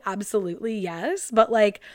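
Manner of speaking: ordinary delivery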